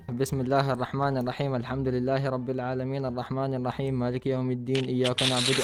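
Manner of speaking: rapid, low recited verses; Quran recitation. Acted. Proving his language to a doubter, recited low and fast. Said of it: "I was reading verses from our book".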